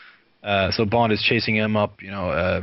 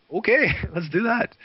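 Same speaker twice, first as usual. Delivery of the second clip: high, bright laughing agreement; laughing okay; 12 semitones higher than usual. Retold or imitated. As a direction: laugh through an amused yes. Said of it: "laughing agreement".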